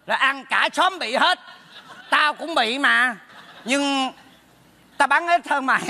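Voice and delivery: ordinary delivery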